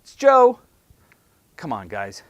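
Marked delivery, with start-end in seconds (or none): 0.0-0.6 loud, high, clipped "Sales, it's Joe"; mock lazy sales answer; curt phone greeting. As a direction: bark it out like a bored salesman. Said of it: "all I hear is".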